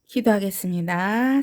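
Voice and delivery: ordinary delivery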